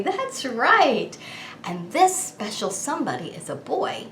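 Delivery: put-on bright sing-song kids show voice; overbright kids party host.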